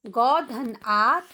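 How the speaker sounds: each sound said slowly and apart; slow sound-by-sound spelling. Shown as a demonstration. Teacher to children, breaking a word into sounds slowly. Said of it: "इसमें चार ध्वनियाँ हैं".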